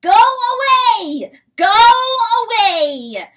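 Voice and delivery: very high, very loud, slow; crowing shout. Acted. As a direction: drive him off with a crow. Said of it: "the rooster crowed".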